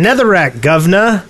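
"Netherrack, governor" in a Cockney British accent. Shown as a demonstration. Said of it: "Does that sound British?".

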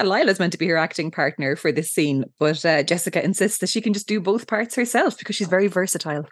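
usual speaking voice

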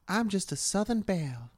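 soft, high Southern belle; US Southern accent. Acted. Co-host doing the requested accent, US Southern belle. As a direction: play a coy US Southern belle. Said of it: "you gotta do that accent".

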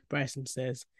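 plain reading voice

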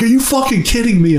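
high, incredulous yell in the theater; shocked outburst. Retold or imitated. In the theater at the ending, a high disbelieving yell. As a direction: squeak out pure disbelief at the screen. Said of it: "I'm like".

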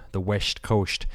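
"West Coast" slurred, each s turned to sh; slurred put-on. Host playfully echoing the time zone, slurred sh-sounds. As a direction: say "West Coast" as a mushy "Wesht Coasht".

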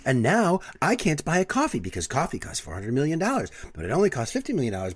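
high, loud mock-outraged pundit; Seth Meyers mocking Tucker Carlson. Recalling a late-night bit to partner, high mocking pundit impression. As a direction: escalate in a high, swooping parody of a TV commentator. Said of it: "Seth Meyers makes fun of Tucker Carlson".

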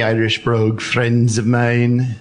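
put-on Irish brogue. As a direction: lay on an Irish accent for the caller. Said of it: "that's a great accent".

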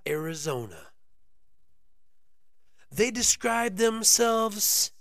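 high, slow stage announcer. Acted. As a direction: introduce the band like an MC. Introduces the band like an MC.

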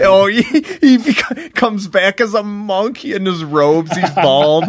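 high, slow, laughing tease; amused mock picture; laughing while picturing a bald monk. Real, not acted. Joking about friend returning monk.

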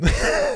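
high loud burst of laughter. Real, not acted. Big laugh.

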